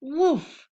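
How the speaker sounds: high, loud yap; yapping dog. A narrator's reading. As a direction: bark like a small dog. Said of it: "yapped the canine".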